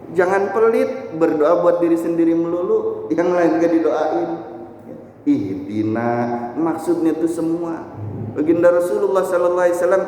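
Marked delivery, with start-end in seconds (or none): none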